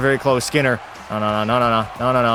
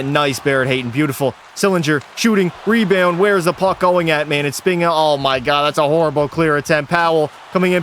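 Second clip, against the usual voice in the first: ordinary talk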